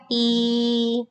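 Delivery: I held high and level; level high first tone. Shown as a demonstration. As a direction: keep I flat and high. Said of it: "ingat first tone".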